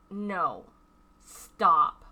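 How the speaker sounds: sudden loud "stop." with a falling end; retold sharp stop. Telling a creep to stop talking to a child, loud sharp command. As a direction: cut them off hard on "stop.". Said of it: "I'm like".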